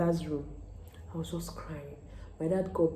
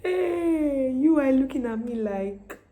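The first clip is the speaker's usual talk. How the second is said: playful loud call; 8 semitones higher, 6 dB louder, slower than usual. Real, not acted. Calling out to someone watching.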